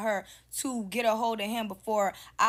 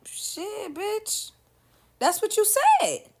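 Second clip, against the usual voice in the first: high-pitched mocking squeak, getting louder; mock high squeal; 13 semitones higher, 6 dB louder, much slower than usual. Retold argument, high far voice.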